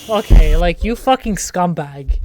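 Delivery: loud, exasperated insult; loud outburst. Real, not acted. Yelling at a game enemy, loud and fed up. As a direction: yell it at the screen.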